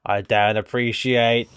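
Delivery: low, soft mock Australian accent; Australian accent, quietly disapproving; Chris Hemsworth. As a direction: do a put-out Australian star. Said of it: "I was doing this Australian accent".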